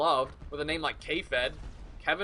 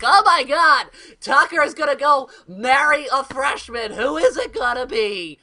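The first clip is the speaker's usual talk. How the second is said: loud, high squealing schoolgirl gossip; gossiping freshman girl; 9 semitones higher, 11 dB louder than usual. Pretend. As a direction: gush like an excited freshman girl. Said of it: "was like".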